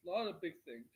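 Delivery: ordinary talk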